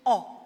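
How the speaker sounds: "Oh" as one loud, isolated vowel; letter sound demo. Shown as a demonstration. Teacher showing a sound the letter makes, "Oh" demonstrated. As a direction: say "Oh" alone, firmly, as a sound. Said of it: "It can say".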